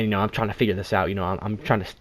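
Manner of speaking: usual speaking voice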